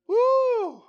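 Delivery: high, loud joyful whoop; preacher's whoop of delight; excited whoop. Real, not acted. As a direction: let out a happy cry. Preacher exclaims about Jesus on donkey.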